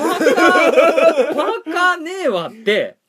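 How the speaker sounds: laughing. Retold or imitated. Retelling his shock while laughing.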